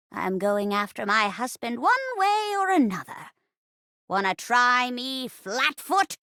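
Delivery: put-on defiant old-lady tough talk; badass grandma. Old wife challenges the detective, defiant granny voice. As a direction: dare them like a tough granny. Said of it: "I'll be playing the part of the poor old rocking chair".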